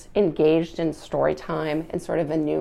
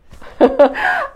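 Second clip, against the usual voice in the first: real laugh; 10 dB louder than usual. Real, not acted. Laughs at own joke.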